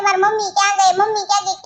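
usual speaking voice

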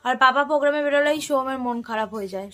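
usual speaking voice

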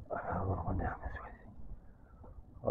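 slow, soft breathy whisper. Real, not acted. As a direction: whisper it slowly as if not to wake them. Whispered aside at plants.

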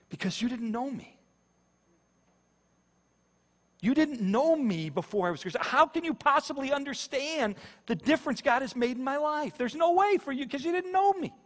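real high, straining, overwhelmed voice; emotionally stuck. Real, not acted. Preacher struggles to tell how God changed his life, overcome and high-pitched. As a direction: plead to be understood, pitch climbing high. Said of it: "this is where I get stuck".

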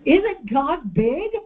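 high, slow, stretched-out Isn't God big; excited question. Shown as a demonstration. Preacher stirs up the congregation, excited. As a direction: sell the wonder of it. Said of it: "Get excited with me".

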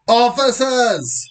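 high, drawn-out call; yelling. Acted. Post office manager summoning police, high drawn-out yell. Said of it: "she yells".

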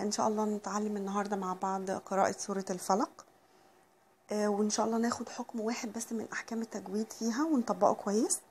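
ordinary talk, nothing marked

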